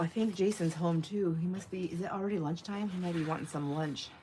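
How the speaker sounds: ordinary delivery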